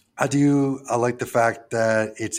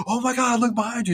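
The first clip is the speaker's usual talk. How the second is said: much higher, lightly voiced scared warning; mock alarmed girl; 13 semitones higher than usual. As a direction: play the frightened girl pointing behind him. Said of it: "she goes".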